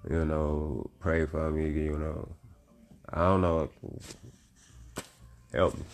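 ordinary talk, nothing marked

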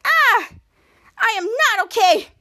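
high, loud cry into slow complaint; retold pained wail. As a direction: imitate an injured man wailing. Retold injured character cries out.

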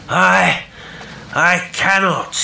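high, slow, halting call; faltering distant voice. A narrator's reading. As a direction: call back haltingly from afar. Distant voice refuses, hesitant.

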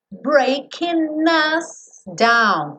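Breaking us linked as "breakin-gus", slow; linked consonant. Shown as a demonstration. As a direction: glide the N of breaking straight into us. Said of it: "posso ligar o N do breaking no us".

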